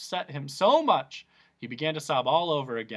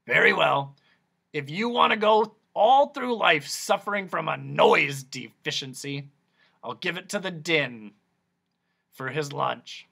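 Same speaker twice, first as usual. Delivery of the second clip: raised, pompous doctor voice; Doctor Discord; 2 semitones higher, 6 dB louder than usual. A narrator's reading. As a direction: proclaim it like a showman quack.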